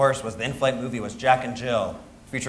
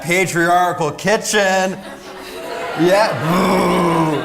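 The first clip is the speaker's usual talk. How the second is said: triumphant proclamation; 4 semitones higher, 6 dB louder, much slower than usual. Acted. Proud triumphant declaration.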